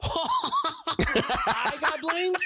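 high laughing shout, stretched out; laughing disbelief. Real, not acted. Laughing disbelief at being blamed.

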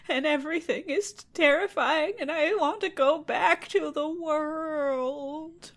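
high, slow, tearful wail; crying princess. Acted. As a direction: whimper it out like a weepy princess. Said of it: "And she says".

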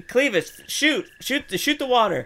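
yelling orders; urgent shout. Real, not acted.

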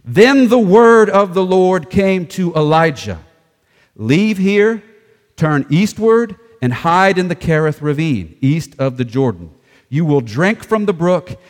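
loud, slow opening with "word" stressed; scripture reading. Acted. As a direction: proclaim God's command deliberately. Reads scripture with announced emphasis.

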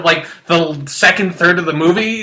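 ordinary talk, nothing marked

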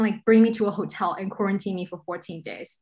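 normal talking voice

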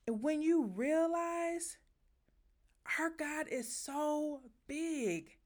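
overwhelmed by awe, drawn out; getting emotional. Real, not acted. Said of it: "I'm getting emotional now".

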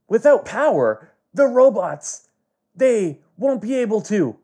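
high, excited character voice; dramatic realization. Acted. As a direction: blurt it out as a sudden hopeful idea. Danny's acted realization in darkness.

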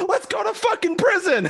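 high excited shouty Cade. Retold or imitated. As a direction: yell it like eager Cade. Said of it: "I could just imagine just frickin' Cade".

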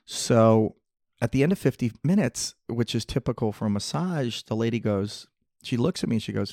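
normal talking voice

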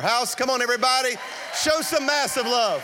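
loud high rallying call; shouting to crowd. Real, not acted. Rallying crowd to applaud pastor.

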